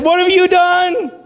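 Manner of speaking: high, loud, slow "what have you done?"; rebuke. Retold or imitated. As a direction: Samuel confronting the king in dismay. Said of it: "and Samuel said".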